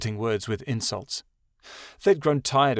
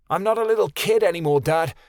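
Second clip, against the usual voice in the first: put-on higher, louder teenage outburst; shouting; 7 semitones higher, 5 dB louder than usual. A narrator's reading. Son shouting at his father, furious. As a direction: yell it at your father. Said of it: "Keanu shouted".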